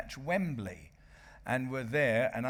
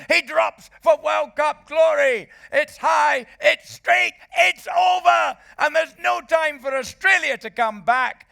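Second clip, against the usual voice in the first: ecstatic screaming commentary, far higher and louder; commentary yell; 14 dB louder than usual. Retold or imitated. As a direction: roar it like the winning kick just went over. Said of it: "and my commentary went".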